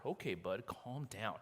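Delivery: soft, low calm-down to an angry man; a soothing shrug of a line; mock calming. Men placating angry Samson, low and soft.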